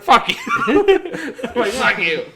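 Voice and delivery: high, loud retort broken by a laugh; mock yell. Retelling the car bit to friends, high loud laughing mock yell.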